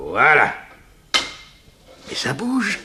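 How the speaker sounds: ordinary talk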